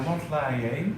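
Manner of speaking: ordinary delivery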